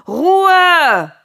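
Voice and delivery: an exasperated father yelling the room silent; furious bellow. Acted. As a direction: roar the word at full volume, high. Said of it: "brüllte Noah".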